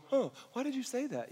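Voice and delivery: hammed-up puzzled girl; mock confused Mary. Pastor voicing Mary questioning the angel, mock confused. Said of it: "She's going".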